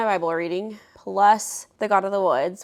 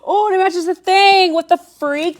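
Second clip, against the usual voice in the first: gasping, high and loud; shocked by the coincidence; surprised gasp; 11 semitones higher, 10 dB louder than usual. Real, not acted. Spooky coincidence with book covers.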